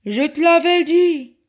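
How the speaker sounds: loud, triumphant genie call; cavernous genie voice. A narrator's reading. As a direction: boom it from inside the bottle. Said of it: "lança une voix caverneuse".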